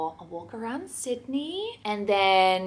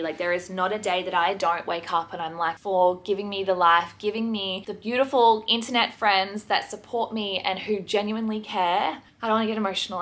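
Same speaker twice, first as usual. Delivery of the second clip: normal talking voice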